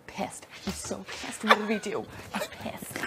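soft, near-whispered imitation; hushed mock gossip; retold hushed worry. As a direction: imitate furtive muttering under the breath. Imitates worried muttering behind someone's back.

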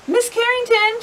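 put-on high-pitched little girl asking politely; schoolgirl calling her teacher. A narrator's reading. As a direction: call on teacher like an eager pupil.

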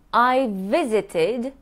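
slow, joining the v sounds; I visited those cities drawn out, v sounds joined; slow linked demo. Shown as a demonstration. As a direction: link visited and those smoothly, slowly. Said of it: "I joined two V's".

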